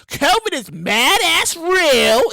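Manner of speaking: very drawn-out Brooklyn delivery. Shown as a demonstration. Comic repeating the covid warning, exaggerated Brooklyn voice. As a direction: lean hard and slow into Brooklyn vowels. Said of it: "that's my Brooklyn voice".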